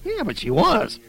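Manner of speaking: put-on a kid pointing it out; child's retort. A narrator's reading. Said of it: "I pointed out".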